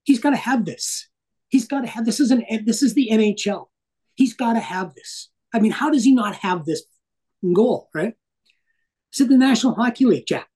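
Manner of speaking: mock-heated complaint to a boss; retold indignant rant. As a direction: replay an argument with disbelief. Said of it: "I'm swearing and swearing and swearing".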